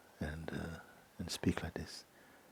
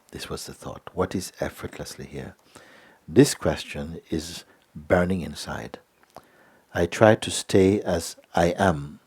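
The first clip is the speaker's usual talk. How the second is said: reading a letter aloud; 5 dB louder than usual. Acted. Reads a visitor's letter aloud.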